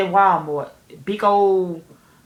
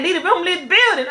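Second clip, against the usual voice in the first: laughing mock order; 10 semitones higher, 4 dB louder than usual. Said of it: "she like".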